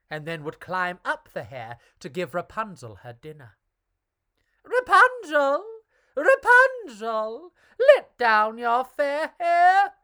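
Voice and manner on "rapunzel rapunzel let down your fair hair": high, loud, slow witch call. A narrator's reading. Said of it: "Horabella would call".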